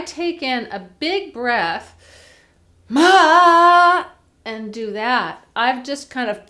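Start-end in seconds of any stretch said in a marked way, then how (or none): 2.9-4.0 "ma" blasted loud on a sudden burst of air; explosive, pushed note; air burst onset. Shown as a demonstration. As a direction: dump all the breath at once. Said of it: "burst my air out".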